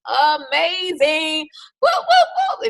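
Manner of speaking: drawn-out, high cheerleader whooping; excited cheer. Real, not acted. Former cheerleader recalling making the varsity team, excited cheer. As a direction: stretch it out, then whoop it up high and loud. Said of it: "I have this excitement in my voice".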